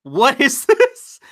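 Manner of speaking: high, slow, laughing disbelief; stunned and laughing; incredulous laugh. Real, not acted.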